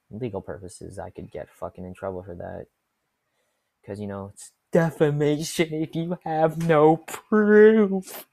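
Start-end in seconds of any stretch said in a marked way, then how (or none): 4.7-8.3 loud, slow, high rant; loud mock-serious rant. Real, not acted. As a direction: ham up the legal worry.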